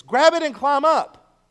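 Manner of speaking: loud, slow call from above; a rescuer calling loudly down to trapped men. Acted. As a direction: shout it down the hole, slow and clear. Rescuer calls down into cave.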